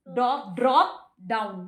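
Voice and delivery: Drop down said slow and low, spaced; teacher's drill; drilling a term. Shown as a demonstration. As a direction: repeat the term for the child to copy. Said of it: "क्या कहते हैं?".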